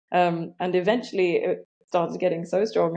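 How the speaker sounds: ordinary delivery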